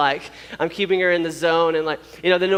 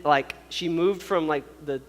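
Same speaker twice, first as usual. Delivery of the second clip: plain reading voice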